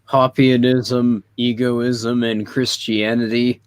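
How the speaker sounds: ordinary delivery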